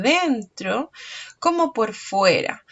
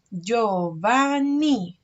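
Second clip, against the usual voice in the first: Giovanni drawn out syllable by syllable, Gio-va-nni; slow syllables; much slower than usual. Shown as a demonstration. Teacher sounding out a name for pupils, very slow. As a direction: clap out Giovanni slowly. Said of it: "sílaba final".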